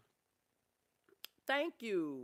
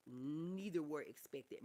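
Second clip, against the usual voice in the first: ordinary talk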